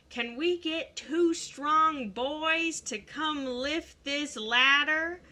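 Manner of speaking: slow Southern drawl; Southern teacher voice, slow; Southern accent. Retold or imitated. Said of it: "that was not her voice. She did not speak in a Southern accent".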